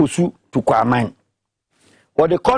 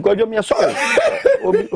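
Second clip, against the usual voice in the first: laughing apology. Real, not acted. Laughing apology mid-argument.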